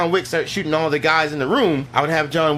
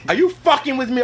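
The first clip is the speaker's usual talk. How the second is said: higher-pitched mock indignation; upset; 8 semitones higher than usual. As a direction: act out an upset woman confronting him. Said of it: "I would have had her get upset".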